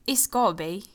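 playful mock British accent. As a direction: put on a bit of British. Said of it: "talking in a British accent".

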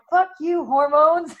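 hammed-up movie yell at the hormones; mock yell. Movie husband yelling at hormones, mock angry. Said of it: "is yelling at her".

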